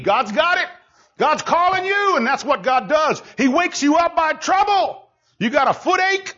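high-pitched, driving preacher delivery; leidenschaftliche Predigt. Real, not acted. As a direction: challenge the congregation with rising urgency. Preaching at full force.

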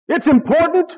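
loud, high, urgent pulpit shout; full-force preaching. Real, not acted. Preacher urging the congregation on ministry, full-force preaching. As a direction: preach it at the top of your voice.

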